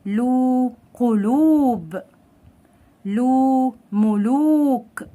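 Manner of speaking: slowly stretched oo in "لو", "قلوب", "ملوك"; long waw madd. Shown as a demonstration. Teacher repeating waw-madd words, drawn-out "لو", "قلوب", "ملوك". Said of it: "واعمل اطالة لللام".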